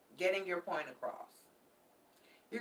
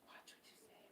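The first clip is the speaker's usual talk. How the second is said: whispered warning; 31 dB quieter than usual. Real, not acted. Tarot reader repeating her caution to viewers, whispered. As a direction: breathe the warning, no voice.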